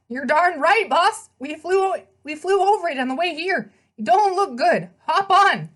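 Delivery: high, brash horse voice with a street twang; pegasus voice. Acted. The pegasus greets his rider in a high, brash horse voice. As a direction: play the cheeky winged horse, all swagger.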